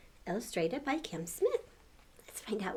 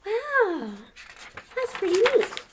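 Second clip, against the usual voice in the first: much higher, awed and louder; amazed; 12 semitones higher, 6 dB louder than usual. Real, not acted. Reacting to the picture for the kids, awed wow. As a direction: marvel at the flying fruit.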